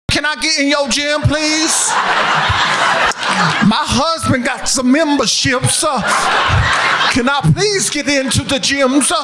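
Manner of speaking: high, prim pleading voice. Acted.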